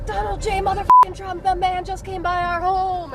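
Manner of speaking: impression of neighbor Alex. Telling reporter how neighbor Alex announced Trump, mock excited impression. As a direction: mimic the neighbor's hyped announcement, slowing at the end. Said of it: "I always do voice impressions".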